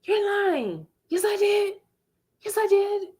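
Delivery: high, sharp accusing mother; mom's accusing voice. Retold or imitated. Mother scolding the child for lying, in a high sharp voice.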